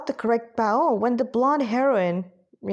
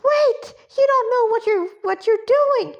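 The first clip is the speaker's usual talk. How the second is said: put-on high, loud childlike squeal; piping, urgent cry to wait up; squeaked; 13 semitones higher, 5 dB louder than usual. A narrator's reading. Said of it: "Alouette squeaked as she ran".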